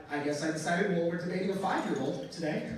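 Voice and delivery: ordinary talk, nothing marked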